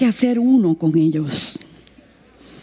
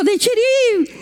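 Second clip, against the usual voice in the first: villager's word; 12 semitones higher, slower than usual. Retold or imitated. Native voiced in story.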